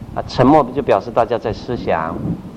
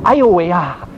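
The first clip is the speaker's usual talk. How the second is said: hammed-up exclamation of amazement; mock over-reaction to a story; overdone listener reaction; 4 semitones higher than usual. Shown as a demonstration. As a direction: overplay the interjection, pitch lifted. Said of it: "不过不要讲太多".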